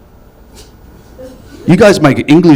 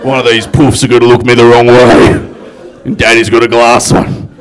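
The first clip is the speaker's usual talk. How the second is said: low, loud menacing bloke; tough street brawler; 5 semitones lower, 4 dB louder than usual. Acted. Man threatening onlookers before a street fight, mock menace. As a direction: play a hard man spoiling for a fight.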